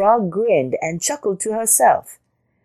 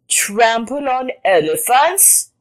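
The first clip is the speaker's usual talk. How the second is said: slow, high incredulous sneer; 6 semitones higher, slower than usual. A narrator's reading. Elephant scoffing at the boast, slow sneer. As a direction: stretch it out scornfully. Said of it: "sneered the elephant".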